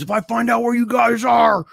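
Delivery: high, sustained imitated threatening shout; menacing scream. Driver hunting kids hidden in the woods, menacing scream. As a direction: holler it out as if across woods. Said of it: "he's screaming and it's echoing in the woods".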